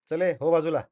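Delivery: brusque dismissal. Acted. Tough man pushing a boy aside, brusque. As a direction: shoo a nuisance out of the way.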